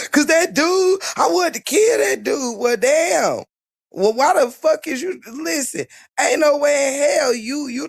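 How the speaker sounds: imitating a tearful rant; mock sobbing rant. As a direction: sob it out like a melodramatic victim.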